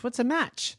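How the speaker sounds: put-on squeaky surprised kid asking; high, puzzled child's question. Child asks about matches.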